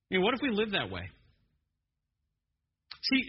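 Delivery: ordinary talk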